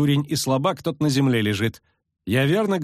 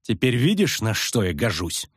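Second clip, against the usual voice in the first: ordinary delivery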